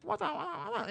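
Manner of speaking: high, soft mock babble; imitated cab driver. Chinese cab driver points at the guy, high mock babble. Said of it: "and whatever he says".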